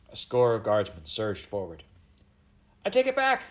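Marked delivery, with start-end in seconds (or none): 2.8-3.4 very high, loud panicked plea; wretched cry. A narrator's reading. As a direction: retract desperately in a high child's voice. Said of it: "cried the wretched Eliza".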